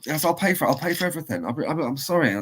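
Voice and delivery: high, pleading mock apology; over-eager, apologetic appeasing; grovelling promise. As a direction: imitate yourself anxiously promising to make amends. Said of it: "I was all grovelly and apologies".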